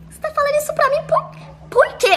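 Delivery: can you believe this guy; indignant disbelief. Retold or imitated. Narrator reacting to his confession, high indignant disbelief.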